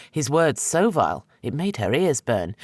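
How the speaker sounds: plain reading voice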